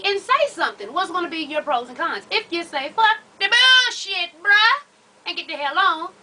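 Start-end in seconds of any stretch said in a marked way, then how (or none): 2.9-6.1 louder, slow fed-up kiss-off; hammed-up telling him to get lost; fed-up dismissal. Woman telling man to leave.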